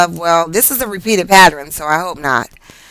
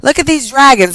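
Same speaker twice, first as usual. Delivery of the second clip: ordinary talk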